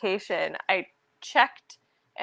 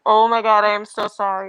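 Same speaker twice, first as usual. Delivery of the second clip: loud apology; 7 dB louder than usual. Retold or imitated. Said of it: "I was just like".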